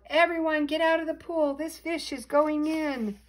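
high, loud warning call; yell. A narrator's reading. Firemen warning swimmers at the pool, yelling. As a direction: shout it out to clear everyone away. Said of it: "The firemen yelled".

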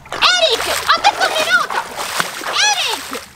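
high-pitched, urgent call; insistent calling. Real, not acted. Girlfriend calls Eric up from underwater, insistent.

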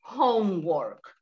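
slow, careful the teacher writes homework; slow model reading. Shown as a demonstration. Teacher modelling the sentence slowly for a student. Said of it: "Ok, ahora bien".

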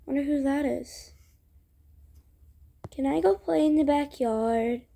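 plain reading voice

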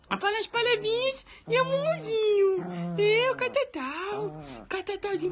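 pet's voice. Acted. Dad voicing his pet claiming he's no old man, high cooing voice. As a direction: coo it like a little pet.

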